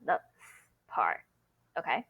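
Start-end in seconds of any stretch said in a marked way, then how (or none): 0.4-0.5 "z" said as a bare "s" hiss; isolated s sound. Shown as a demonstration. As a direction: sound out "z" as the "s" of sun. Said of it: "To say sun".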